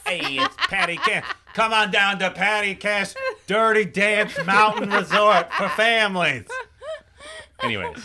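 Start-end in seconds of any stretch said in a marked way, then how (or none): 0.1-6.4 cheesy ad voice. Pretend. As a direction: sell it like a cheesy ad. Mock resort advert.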